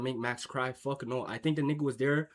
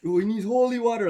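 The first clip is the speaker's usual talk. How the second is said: high, loud mock alarm; joking loud roast; 9 semitones higher, 9 dB louder than usual. Roasting a homie leaving the club, loud mock alarm. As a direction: yell it to roast your homie. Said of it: "yeah, they crack jokes".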